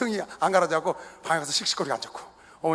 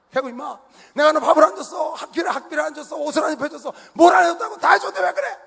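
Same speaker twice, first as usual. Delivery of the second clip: much higher, louder scolding rant; father shouting back; 10 semitones higher, 5 dB louder than usual. Retold or imitated. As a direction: list everything you gave him, yelling.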